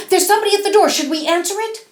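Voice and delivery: put-on high, loud and eager little girl; eager Rose Red. Rose Red calling out eagerly to her mother. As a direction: blurt it out, eager to be first. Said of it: "said Rose Red".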